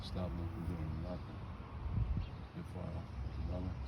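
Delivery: normal talking voice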